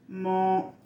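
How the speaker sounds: normal talking voice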